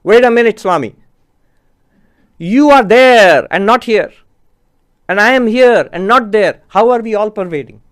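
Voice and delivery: high, loud challenge from the back; objecting monk. Retold or imitated. Monk at the back questioning the Swami, loud objection. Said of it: "the monk at the back of the hall asked".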